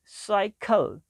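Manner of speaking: साइकिल said slowly, each sound clear; careful word demo. Shown as a demonstration. Teacher teaching the letter C, careful word demo. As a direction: model साइकिल for the class. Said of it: "इस तरह साइकिल".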